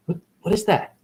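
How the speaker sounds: ordinary delivery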